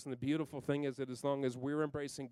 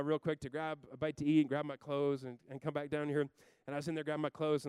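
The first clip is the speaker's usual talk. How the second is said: ordinary talk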